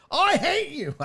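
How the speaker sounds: high mock hateful line; mock yell at screen. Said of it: "yelling at the screen".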